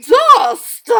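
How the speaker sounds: loud, high horrified cry; shocked gasp. Acted. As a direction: recoil in horror at the dust. Stepmother discovers dust.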